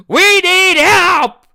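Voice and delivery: a huge, high, stretched-out wail for help; mock shrieking plea. Friend mocking him in comments, hammed-up wail. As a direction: scream it like a desperate cry. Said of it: "be like".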